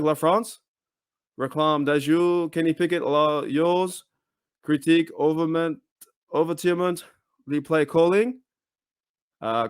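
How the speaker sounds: stumbling, amateur go at French; French accent attempt. Shown as a demonstration. Host struggles through a French fan tweet, in an attempted French accent. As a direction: read it out in your best attempted French accent, phrase by phrase. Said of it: "gonna try my best French accent".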